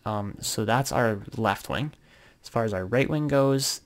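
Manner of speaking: usual speaking voice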